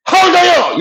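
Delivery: high, quarrelsome English demand; angry challenge. Shown as a demonstration. Teacher showing an angry age challenge, high indignant voice. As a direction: throw it out as a fighting challenge. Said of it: "말하다가 화나면 그러지".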